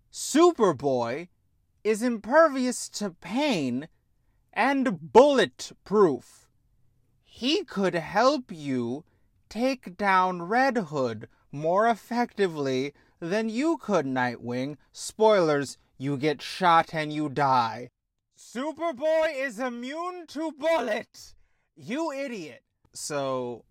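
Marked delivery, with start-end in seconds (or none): 0.1-11.3 loud, slowed emphasis; emphatic. Real, not acted. Ranting about Superboy's powers. 18.4-22.6 high, strained yell at a character; exasperated yell. Real, not acted. Yelling at Nightwing on screen.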